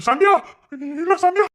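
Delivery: worried husband calling his wife; urgent calling. Acted. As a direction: shout her name urgently.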